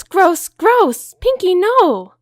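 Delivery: high, loud horrified shriek; high loud shrieking disgust; disgusted Rarity. Acted. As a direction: recoil in horror.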